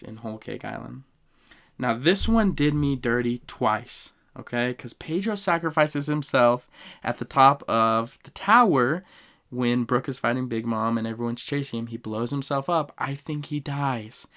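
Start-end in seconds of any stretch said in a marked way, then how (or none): none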